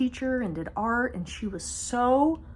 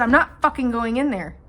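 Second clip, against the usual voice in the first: loud refusal; 3 semitones higher, 7 dB louder than usual. Retold or imitated. Refusing to enter room.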